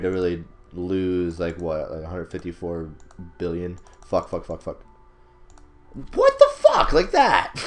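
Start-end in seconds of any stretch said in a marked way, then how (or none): 6.1-7.5 very high, loud cry of disbelief; outraged shriek. Real, not acted. Player killed suddenly in a risky fight, outraged shriek. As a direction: shout it at the top of your range, incredulous.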